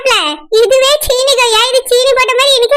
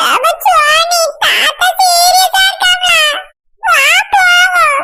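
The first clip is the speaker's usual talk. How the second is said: high, slow hammed-up wail; wailing shout; 6 semitones higher, much slower than usual. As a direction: cry it out, overdone. Child wails about grandpa. (உயர்ந்த குரலில் மிகைப்படுத்திய அழுகை)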